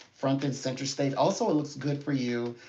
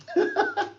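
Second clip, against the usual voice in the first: very high, real squeal of laughter; a sudden high giggle; 4 dB louder than usual. Real, not acted.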